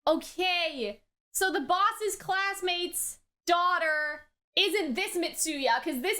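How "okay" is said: ordinary delivery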